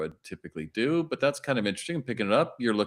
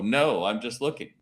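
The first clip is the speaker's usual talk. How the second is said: normal talking voice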